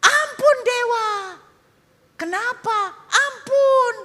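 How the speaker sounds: high, loud wailing for mercy; terrified pleading. Acted. Kneeling tribe begs the false god for mercy, wailing. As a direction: beg for your life, shrieking. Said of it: "Terus dia bilang".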